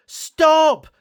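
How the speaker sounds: high, loud cry of stop; puffing shout. Acted. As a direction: shout it out to halt him. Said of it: "Thomas puffed".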